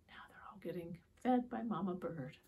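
soft, fast whisper; whispered aside. Real, not acted. Teacher whispering to children that the birds are fed.

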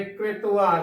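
ਕ੍ਰਿਤਵਾ said kre-tu-va; "kretuva" for ਕ੍ਰਿਤਵਾ; demonstrated pronunciation. Shown as a demonstration. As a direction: add a short u between t and v in ਕ੍ਰਿਤਵਾ. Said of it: "ਕ੍ਰਿਤਵਾ ਉਚਾਰਨ ਕਰਨਾ ਹੈ".

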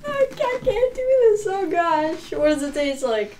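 high, near-crying dread; whiny panic. Real, not acted. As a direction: squeal in fear of the spice. About to eat hot chips.

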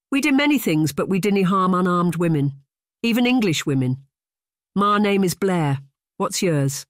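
put-on Scottish accent, steady and proud; Scottish Highlander accent. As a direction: introduce yourself as a Highland warrior. Blair introduces himself.

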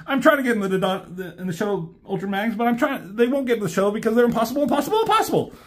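high, very fast babbling excuse; frantic Blur. Retold or imitated. Blur excusing himself to Ultra Magnus, fast high babble. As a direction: rattle it off in a panic.

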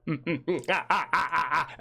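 loud open laugh; real laugh breaking up the intro; hearty laugh. Real, not acted.

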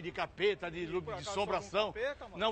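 ordinary delivery

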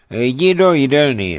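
firm order. A narrator's reading. Merchant sending the messenger to eat, firm order. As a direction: dismiss him with an order.

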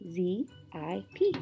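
usual speaking voice

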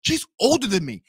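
slow, stretched exasperation rising in pitch; drawn-out, fed-up venting; mad and incredulous. Real, not acted. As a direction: drag out each stressed word, building to outrage. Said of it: "now I'm even more mad".

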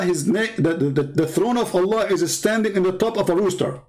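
usual speaking voice

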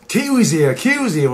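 high-pitched imitation of excited fans; retold chant, each call high then falling; mock crowd yell. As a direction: call it out like a street crowd, without full volume. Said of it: "screaming, yelling".

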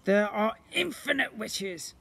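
booming slime creature. Acted. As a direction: play the magical blob, grand and eager. Slime voice, slow.

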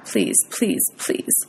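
breathy, hushed begging; whispered pleading. A narrator's reading. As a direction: plead under your breath. Mother urging the car onward.

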